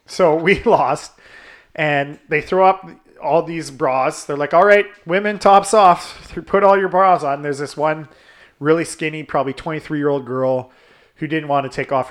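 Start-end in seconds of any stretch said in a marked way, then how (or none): 4.5-7.4 slightly raised, louder party-host call; lightly acted announcer rallying the crowd; cruise host calling out. Acting out cruise host's announcement.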